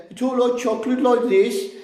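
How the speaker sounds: high-pitched mock Brummie; Brummie accent. Shown as a demonstration. Imitating how Brummies say chocolate, Brummie accent. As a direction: put on a hammed-up Brummie sing-song. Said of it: "because Brummies talk like this".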